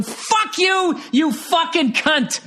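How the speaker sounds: sudden high-pitched mock rage; mock furious outburst. Comedian to online commenter, hammed-up furious outburst.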